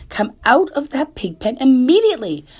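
high, loud furious mother; angry scolding. Acted. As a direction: yell the order. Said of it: "cried Missus Arable".

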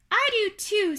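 high, loud little voice; small creature pitched well up; second little spider. Acted. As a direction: announce it as a tiny spider.

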